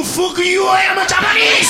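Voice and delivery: hammed-up outrage at being called Chinese; shrill-pitched mock fury; mock offended shout. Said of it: "Oh, no".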